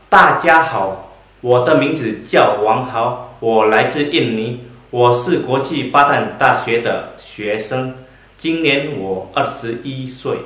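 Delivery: Mandarin self-introduction spoken slowly, word by word; slow model introduction. Shown as a demonstration. Teacher demonstrates self-introduction to class, slow and clear. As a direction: model it slowly for students to copy. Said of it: "Contohnya berupa".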